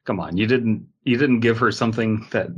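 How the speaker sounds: usual speaking voice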